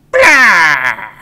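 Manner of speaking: loud, cheeky creature shout; creature blah. A narrator's reading. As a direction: blast a rude blah at him. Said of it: "and went".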